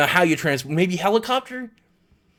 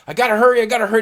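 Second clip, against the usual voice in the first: frantic hurry; 7 semitones higher, 5 dB louder, much faster than usual. Retold or imitated. Zookeeper rushing, retold.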